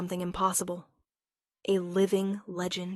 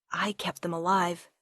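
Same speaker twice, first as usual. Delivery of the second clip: plain reading voice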